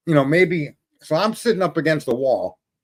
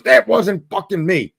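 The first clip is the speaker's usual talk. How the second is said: slower, harder denial in a changed voice; insistent angry denial; 5 semitones higher, 3 dB louder than usual. Retold or imitated. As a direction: spit it out, fed up with being blamed. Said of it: "He goes".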